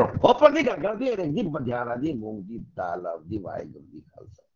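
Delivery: rebuff the questioner in nonsense, fading away; indignant gibberish retort. Acted. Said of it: "तुम कौन होते हो पूछने वाले". (चिढ़कर जवाब, फिर धीमी बड़बड़ाहट)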